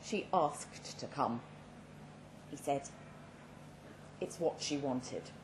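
understated, weary and slow; resigned. Acted. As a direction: say it as a man with nothing left. Dying man explains ex-wife's wish.

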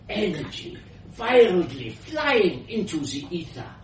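put-on high, rapturous old scientist, slowing grandly; elderly foreign woman. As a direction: marvel at it in a foreign accent. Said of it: "elderly woman's voice, powerful and slightly foreign".

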